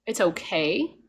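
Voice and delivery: normal talking voice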